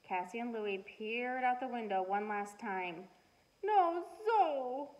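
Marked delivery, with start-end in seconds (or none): 3.6-4.9 put-on high little toddler voice, sighing; small sighing toddler voice; baby voice sighing. A narrator's reading. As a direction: sigh it out like a baby. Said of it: "baby Louie said with a sigh".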